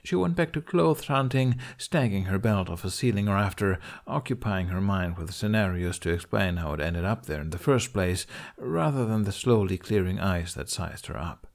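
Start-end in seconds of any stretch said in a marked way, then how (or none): none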